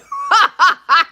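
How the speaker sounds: a loud, high laugh at herself; laughing off her own rant; loud burst of laughter. Real, not acted. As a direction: let a real laugh burst out.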